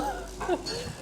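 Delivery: airy, breathy laugh; a breathless little laugh. Real, not acted. Laughs after a surprising lift.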